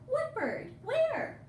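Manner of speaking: excited little asking voice; curious surprise. Acted. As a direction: look around eagerly. Curious voice looking for bird.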